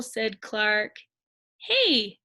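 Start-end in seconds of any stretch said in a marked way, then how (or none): 1.6-2.2 high, delighted cry of discovery; a shark cheering at his own rhyme, high and excited. A narrator's reading. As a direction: pitch it up like a happy realization. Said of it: "he cried".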